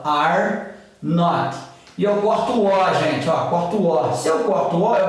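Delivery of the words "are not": slow, drawn-out. Shown as a demonstration. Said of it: "eu vou escrever junto, ó".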